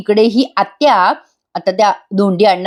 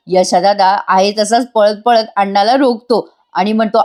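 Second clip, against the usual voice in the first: normal talking voice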